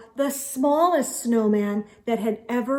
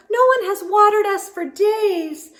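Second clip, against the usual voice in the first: high, loud squeaky flower voices; squeaky little flower voice; squeaking flowers; 9 semitones higher, 3 dB louder than usual. A narrator's reading. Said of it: "they squeaked some more".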